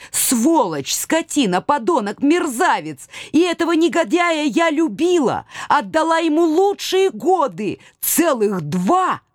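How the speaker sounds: high, sputtering cursing in rage; impotent fury. Acted. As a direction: stamp and curse him. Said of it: "в бессильной ярости, я затопала ногами".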